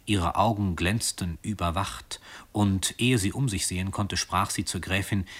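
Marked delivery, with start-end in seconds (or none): none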